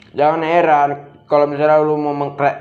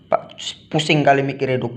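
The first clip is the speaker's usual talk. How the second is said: ordinary talk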